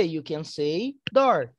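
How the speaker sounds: slow, clear teacher answer; slow model answer. Shown as a demonstration. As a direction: spell out the answer slowly. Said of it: "Aí eu vou responder". (resposta lenta de professora)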